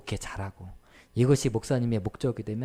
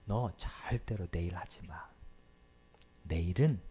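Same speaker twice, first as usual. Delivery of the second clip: hushed voice of God; 5 semitones lower, 6 dB quieter, slower than usual. Retold or imitated. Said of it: "이렇게 말씀하시더라는 거예요".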